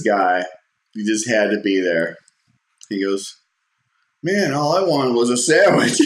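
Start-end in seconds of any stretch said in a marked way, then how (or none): 4.2-6.0 louder, laughing through the words; laughing through the quote. Retold or imitated. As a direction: quote him while cracking up. Punchline quote, teller laughing.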